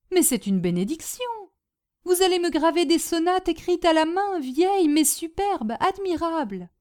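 gushing hostess, easing into practical talk; enthusiastic Madame Bombec. Acted. Hostess planning to earn money with her guests, enthusiastic. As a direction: rave about the plan, then explain.

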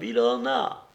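high-pitched little female voice; small woman's cry. A narrator's reading. Girl in the arena crowd seeing a fighter fall, small high cry. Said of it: "cria une petite voix de femme".

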